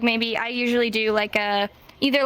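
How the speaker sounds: ordinary talk, nothing marked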